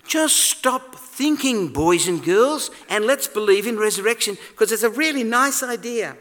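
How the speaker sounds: high, sing-song mock plea to stop thinking; mock sugary preacher. Preacher mocking a naive faith, high and sing-song. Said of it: "He's not saying".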